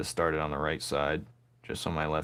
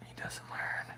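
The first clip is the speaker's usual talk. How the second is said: breathy whisper; 12 dB quieter than usual. Real, not acted. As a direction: whisper it under your breath. Muttered aside.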